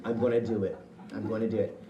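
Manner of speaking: low, quiet self-pep talk; a kid working up courage to throw; psyched whisper. Retold or imitated. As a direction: steel yourself under your breath. Psyching himself up.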